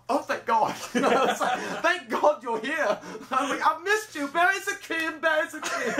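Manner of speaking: high, gushing fanboy reunion; hammed-up joyful relief. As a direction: gush in a high, mock-emotional voice. Acting out his relieved joy.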